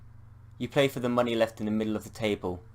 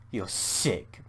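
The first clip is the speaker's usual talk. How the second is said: usual speaking voice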